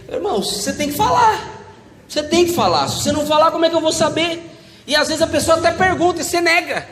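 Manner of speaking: high-pitched, insistent exhortation; insistent preaching. Real, not acted. Preacher telling brothers they must speak up, insistent. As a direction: press the point on the congregation.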